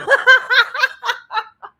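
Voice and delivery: high, loud cackling laugh; big high-pitched laugh. Real, not acted. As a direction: burst out laughing at your own joke. Laughs at own weed joke.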